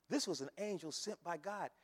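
usual speaking voice

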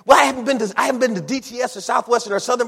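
high, hurried mock excuse; protesting excuse. Voices believer making excuses.

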